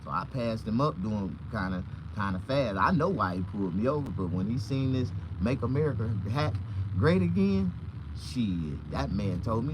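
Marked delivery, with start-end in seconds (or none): none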